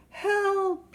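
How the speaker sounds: high, loud mock bellow for help. Trapped elephant calling into the night, loud bellow. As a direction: call out loudly from the ravine. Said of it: "he bellowed".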